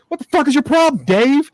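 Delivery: hammed-up angry professor; mock snap at Dave. Andre Chase snaps at a reporter, mock angry. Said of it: "and he goes".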